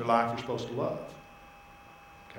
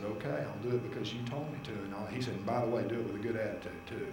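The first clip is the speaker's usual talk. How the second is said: quiet surrender; 6 semitones lower, 10 dB quieter, faster than usual. Retold or imitated. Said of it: "quiet exchange with the Lord".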